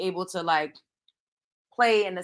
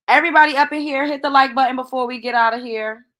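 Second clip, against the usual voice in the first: loud hype call to the chat; rally the viewers, loud and bright; 5 semitones higher, 6 dB louder than usual. Real, not acted. Host rallying chat for likes.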